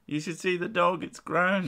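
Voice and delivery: high, mock gushing voice; imitating doting sister. Sister inviting friend to see dog, high mock doting. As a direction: pitch it up, fussing over a pet. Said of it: "she's all over him like a newborn baby".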